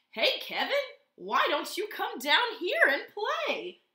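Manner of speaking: loud friendly call down from below; shouted invitation. Acted. Wombat calling up to Kevin in the tree, loud and friendly. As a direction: call up to the tree to come play. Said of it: "shouted one day".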